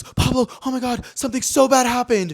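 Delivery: high, loud frantic phone-call voice; alarmed mock panic. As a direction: blurt bad news in an exaggerated panic. Acting out manager's alarmed phone call.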